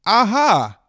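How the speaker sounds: high, loud aha of finishing; triumphant aha. Acted. Finishing the poem late at night, triumphant aha. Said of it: "I said when I was finished".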